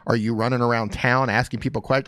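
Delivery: normal talking voice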